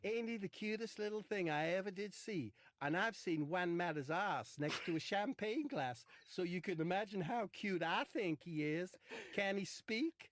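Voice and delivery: high, gushing comic character voice; put-on character voice. Comic retries Marcus admiring the robot, put-on high voice. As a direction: pitch it up and gush like a silly caricature. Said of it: "Would he talk like that?".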